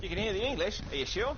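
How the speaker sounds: Australian accent. Shown as a demonstration. Gamer challenged on English showing through, Australian accent. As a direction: question it in a put-on Australian accent. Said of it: "I can still hear the English coming through".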